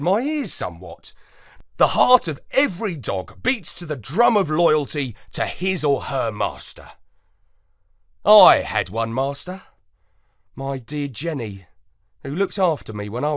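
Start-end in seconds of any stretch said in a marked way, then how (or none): none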